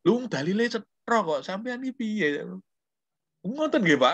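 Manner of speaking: high, bantering Javanese student voice. Retold or imitated. Lecturer voicing a student teasing him in Javanese, pitched high. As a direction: answer back cheekily in Javanese.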